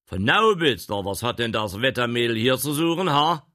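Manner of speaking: loud, high echo of the name, fast; surprised gentleman. Acted. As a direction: bark it back in disbelief.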